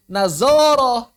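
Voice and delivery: loud, drawn-out "nazoroh" with buzzing z; mock the wrong reading "nazoroh", stretched out; "nazoroh" with plain z in place of dh; mispronounced dh as z. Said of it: "Itu salah".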